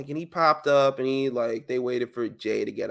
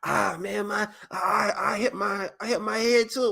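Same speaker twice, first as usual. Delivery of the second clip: higher, lightly whiny; mock fake hurt; 8 semitones higher than usual. Kid pretending he got hurt too, mock whine. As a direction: fake it to match.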